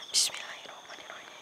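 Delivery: voiceless whispered prayer. Real, not acted. Ghost hunter whispers a prayer before the spirit. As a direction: murmur it under the breath. Praying over the crying spirit.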